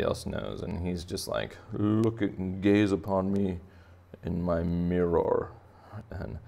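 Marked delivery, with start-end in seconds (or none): 1.7-5.5 slow, grand, drawn-out self-admiration; vain angelfish. Acted. Angelfish admiring himself in the mirror, grandly vain. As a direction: savour every word like an adoring narcissist. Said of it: "this angelfish knows how beautiful he is".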